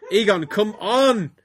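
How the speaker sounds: loud, high plea; begging. A narrator's reading. Lover urging Egon on as the narrator reads, begging. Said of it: "he begged".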